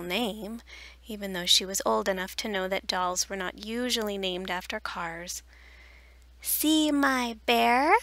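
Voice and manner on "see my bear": put-on slow, high, loud toddler; little child voice. A narrator's reading. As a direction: show off the bear like a toddler. Little Willa Jean shows bear.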